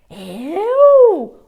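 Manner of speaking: shouted high "me?!"; outraged, disbelieving yelp; indignant surprise. Retold or imitated. Carer accused of feeding the anxiety, high shouted surprise.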